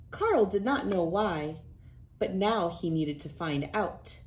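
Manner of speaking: ordinary delivery, nothing marked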